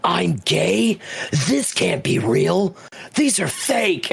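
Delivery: high, slow, disbelieving character voice; shocked denial. Retold or imitated. Voicing the hero who sees his deleted memory, shocked denial. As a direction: play the hero refusing the truth. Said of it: "He's like".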